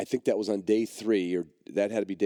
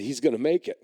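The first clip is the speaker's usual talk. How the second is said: excited; 7 semitones higher, 4 dB louder than usual. Retold or imitated. Joyful realization dog survives.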